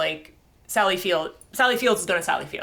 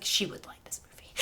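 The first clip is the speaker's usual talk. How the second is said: whispered thought; 10 dB quieter than usual. Retold or imitated. Private thought quoted.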